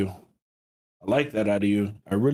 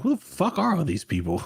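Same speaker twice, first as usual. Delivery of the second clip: mock bewilderment; 7 semitones higher than usual. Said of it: "I'm like".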